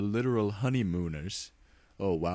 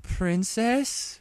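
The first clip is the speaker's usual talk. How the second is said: confused question; 14 semitones higher, 4 dB louder than usual. Acted. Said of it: "both ponies asked".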